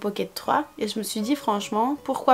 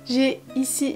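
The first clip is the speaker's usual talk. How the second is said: ordinary delivery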